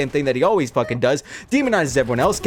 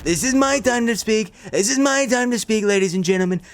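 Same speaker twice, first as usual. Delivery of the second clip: higher, bossy Trump-style rant; mock Trump voice; 6 semitones higher than usual. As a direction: lecture them like a pompous Trump. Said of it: "if I wasn't using the voice".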